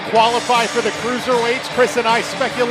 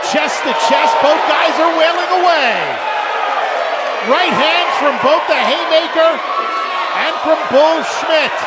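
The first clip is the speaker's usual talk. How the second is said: excited play-by-play; 4 semitones higher than usual. Real, not acted. Live play-by-play of exchange.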